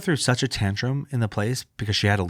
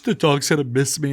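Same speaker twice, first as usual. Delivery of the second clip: sobbing daughter. Retold or imitated. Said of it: "She's sobbing".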